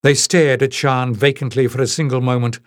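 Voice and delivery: plain reading voice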